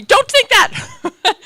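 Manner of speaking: high, loud and slow, laughing through it; drawn-out scolding with a laugh; mock stern order. Hairdresser scolding the scared client, laughing. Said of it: "she said to me".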